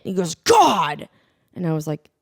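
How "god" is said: loud, higher exasperated outburst; mock yell of frustration; imitated screaming. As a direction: burst out like the fed-up son. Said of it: "screaming at this dog".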